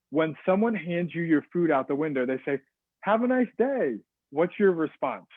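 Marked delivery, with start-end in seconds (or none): 3.0-4.0 high, drawn-out sing-out greeting; drive-through server. Acted. Someone handing food out the window, chirpy server voice.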